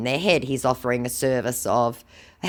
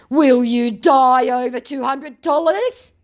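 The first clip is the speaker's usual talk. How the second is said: challenging; 12 semitones higher, 7 dB louder, slower than usual. Retold or imitated. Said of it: "he challenges".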